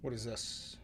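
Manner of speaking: low, soft mumble; puzzled aside. Real, not acted. Streamer muttering confusion at the clip. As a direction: mutter it, baffled.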